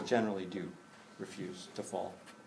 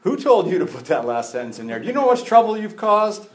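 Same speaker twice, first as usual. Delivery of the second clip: loud, high mock exasperation; preacher teasing Matthew in heaven, loud mock grumble; mock complaint to Matthew; 8 semitones higher, 10 dB louder than usual. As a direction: scold the gospel writer in jest. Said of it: "sit down with Matthew and say".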